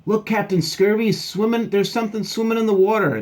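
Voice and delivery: high, loud, urgent alert; excited sailor dog. Acted. Dog spotting something in the water, high excited call. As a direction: call the captain to look.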